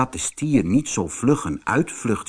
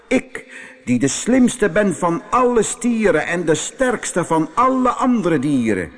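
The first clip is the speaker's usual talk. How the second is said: boastful bull; 9 semitones higher than usual. Acted. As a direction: crow like a proud, bossy bull. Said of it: "De stier bleef staan en zei".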